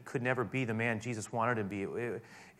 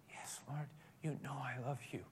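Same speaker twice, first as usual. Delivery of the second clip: much softer, humbled answer; hushed Peter reply; 2 semitones higher, 13 dB quieter than usual. Acted. Peter answers third time.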